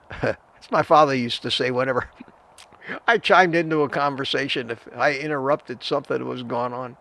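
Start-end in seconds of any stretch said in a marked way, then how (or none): none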